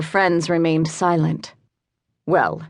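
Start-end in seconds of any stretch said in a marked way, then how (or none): 2.3-2.6 cold voice. Acted. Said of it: "asked the voice again".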